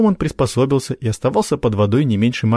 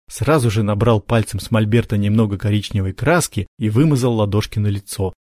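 usual speaking voice

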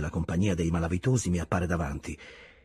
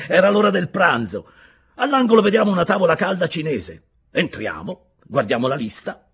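higher, louder, lively telling with laughs; cheerful storyteller figure; 12 semitones higher, 10 dB louder than usual. A narrator's reading. Fellow prisoner recalling Ulaanbaatar, lively and laughing. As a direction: tell it like a happy memory. Another prisoner tells an anecdote.

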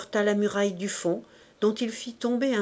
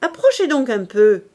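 very loud, defiant shout; loud roar of defiance; roared challenge; 3 semitones higher, 9 dB louder than usual. A narrator's reading. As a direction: bellow a dare at the police. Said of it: "cria une voix qui n'était pas une voix d'homme".

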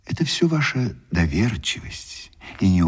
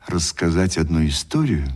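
normal talking voice

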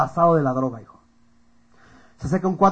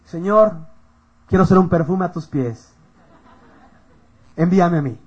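slow, solemn pretend prayer; mock prayer; 3 dB louder than usual. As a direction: imitate a pious plea to the Lord. Oración fingida antes del antro. (oración solemne fingida)

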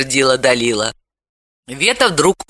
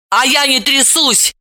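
angry shout; 10 semitones higher than usual. A narrator's reading. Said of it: "зло крикнула вслед ей Далила".

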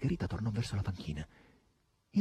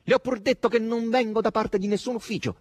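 loud, very high exasperated protest; high, loud insistence; raised voice; 14 semitones higher, 7 dB louder than usual. A narrator's reading. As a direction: raise your voice in irritation. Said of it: "alzò la voce".

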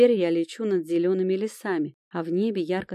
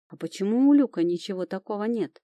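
ordinary delivery, nothing marked

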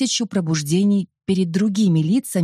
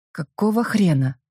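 ordinary delivery

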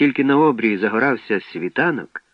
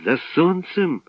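astonished cry; 7 semitones higher, slower than usual. A narrator's reading. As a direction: echo it as a high, startled question. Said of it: "скрикнув Славко". (здивований вигук високим голосом)

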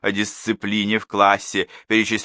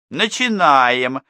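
louder, higher, slow call to order; announcing; 6 semitones higher, 6 dB louder, much slower than usual. A narrator's reading. Class teacher opens the parents' meeting, loud announcement. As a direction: call the room to start. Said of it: "оповестила классная руководительница".

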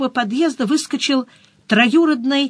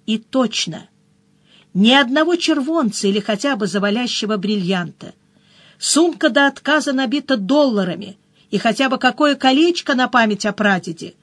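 ordinary delivery, nothing marked

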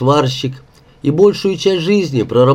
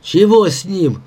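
higher-pitched, impatient bark; mild barking; 6 semitones higher than usual. A narrator's reading. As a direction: snap at the others, without much volume. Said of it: "залаял Васька". (раздражённо, слегка лающе)

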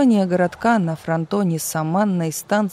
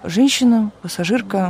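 frightened; 4 semitones higher, 4 dB louder than usual. A narrator's reading. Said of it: "мгновенно пугаясь".

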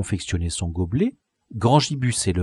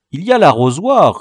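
higher, louder mock-helpful quip; teasing; 6 semitones higher, 8 dB louder than usual. A narrator's reading. Grand Gibus teasing thirsty Boulot, cheeky. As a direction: toss it off as a cheeky joke. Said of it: "répondit Grand Gibus facétieux".

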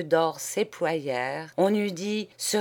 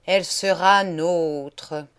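imperious; 6 dB louder, slower than usual. Acted. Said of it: "répliqua le poète impérieusement".

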